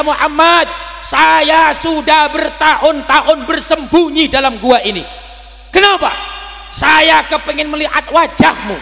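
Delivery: permohonan ular, tinggi dan keras; ular memohon. Acted. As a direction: memelas lantang seperti ular. Snake begs Nabi. (ular memohon maaf kepada Nabi, tinggi dan keras)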